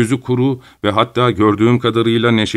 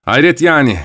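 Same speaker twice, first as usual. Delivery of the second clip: louder and higher, flaring anger; angry; 6 semitones higher, 5 dB louder than usual. A narrator's reading. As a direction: snap back at the doctor. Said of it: "gözleri öfkeyle parladı". (öfkeyle parlayarak)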